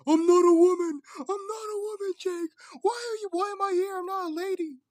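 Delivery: very high, loud wailing protest; hammed-up high-pitched yelling. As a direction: scream it in a squealing falsetto. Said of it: "I was yelling, muffling".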